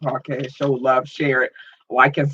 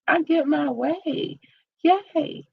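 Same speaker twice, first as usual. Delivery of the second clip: excited squeal; 13 semitones higher, slower than usual. Real, not acted. Celebrating getting the interview.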